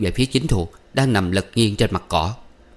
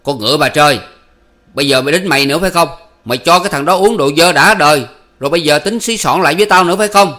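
loud, raised-pitch angry tirade; roaring; 6 semitones higher, 8 dB louder, faster than usual. A narrator's reading. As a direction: roar accusations in a jealous rage. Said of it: "Chính Thuộc bước tới gầm lên".

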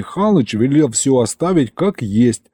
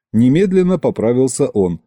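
ordinary delivery, nothing marked

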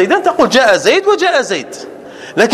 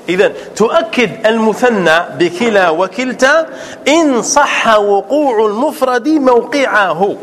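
normal talking voice

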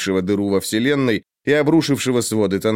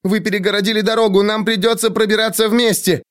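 rushed, high urgent shout; fast urgent; 9 semitones higher than usual. A narrator's reading. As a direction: blurt it out in a hurry. Shouting while running.

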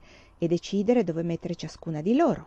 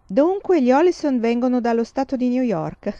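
louder, higher, mock-impressed echo; amused; 6 semitones higher, 6 dB louder than usual. A narrator's reading. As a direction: tease the boast with a chuckle. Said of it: "Papà era divertito". (papà ripete la vanteria di Nellie divertito, più alto e forte)

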